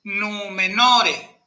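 "numenore" lento, con U y O largas; sostén la "u" y la "o" de "numenore"; vocales largas. Shown as a demonstration. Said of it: "es un buen ejemplo de cómo es". ("numenore" silabeado con dos vocales largas)

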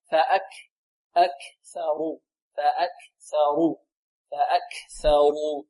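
slow فأكثروا with audible breath after ك; breath on kaf. Shown as a demonstration. Teacher shows students the whispered kaf, slow breathy ك in فأكثروا. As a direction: stop on ك in فأكثروا and let breath flow. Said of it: "نفس، هكذا".

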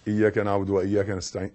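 fast, careless رushed recitation; rushed reading. Shown as a demonstration. Teacher mocking a hurried Fatiha. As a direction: gabble it quickly without care. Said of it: "ما تقولش".

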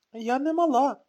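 put-on a little girl insisting; insistent child. A narrator's reading. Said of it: "наголосила донька". (дитяче наполегливе «Я не мала»)

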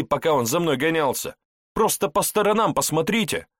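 heated, loud, high-pitched outburst; exploding in protest. A narrator's reading. Suspect defends himself to the investigator, exploding in protest. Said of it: "но тут буквально взорвался".

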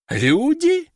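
startled, high-pitched demon cry; surprised. A narrator's reading. Little demon seeing the summoners, startled high cry. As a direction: blurt it out in shock, voice jumping up. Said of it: "удивился демонёнок".

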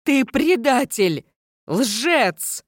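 high, drawn-out cry of betrayal; anguished, high-pitched accusing cry; desperate cry. A narrator's reading. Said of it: "с отчаянным криком".

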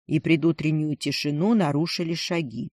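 usual speaking voice

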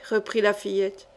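plain reading voice

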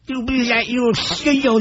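mock lisping voice; lightly lisped "you know"; imitating a lisp. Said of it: "without sounding like this".

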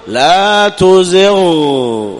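لا تزغ drawn out with the غ sounded on its own; very slow clear ghain. Shown as a demonstration. Teacher drills the separate ghain in slow motion. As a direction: pause on the غ. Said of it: "فتقول".

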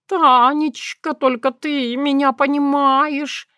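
high slow sighing cat voice; breathy cat plea. A narrator's reading. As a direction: sigh it out with relief. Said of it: "выдохнул он".

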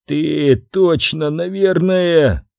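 slow, broken by coughing; gasping out words between coughs; halting through coughs. Acted. Guard coughing, stammering. (сквозь кашель, с трудом)

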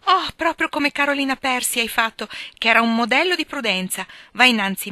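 high, loud, delighted teasing; amused exclamation. A narrator's reading. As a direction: exclaim merrily, without actual laughter. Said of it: "esclamò ridendo".

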